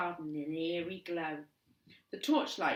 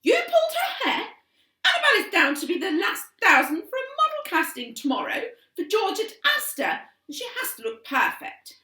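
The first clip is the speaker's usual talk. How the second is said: high, loud appalled mum; shocked fury at her daughter; outraged mother; 14 semitones higher, 13 dB louder than usual. A narrator's reading.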